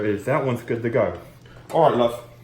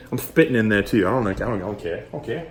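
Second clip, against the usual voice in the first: ordinary talk, nothing marked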